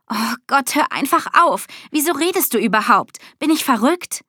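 rising pitch, as if doubting your own sanity; agitated disbelief. A narrator's reading. Girl begging the talking cat to stop, agitated.

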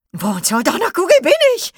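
very high, loud squawking creature voice; shrieking Pogo. Acted. Pogo the blue ball shrieks in confusion, very high and loud. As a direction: screech it out like a confused little gadget-creature. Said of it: "kreischte Pogo".

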